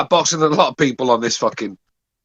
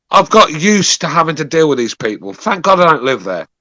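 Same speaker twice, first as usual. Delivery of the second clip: ordinary talk, nothing marked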